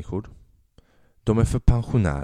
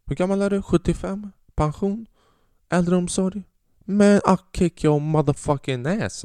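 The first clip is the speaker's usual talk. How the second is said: mock taunt; 8 semitones higher than usual. Comic silently taunting the old lady, high mocking sneer. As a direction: sneer at her age, then threaten. Sizing up the old woman with eyes.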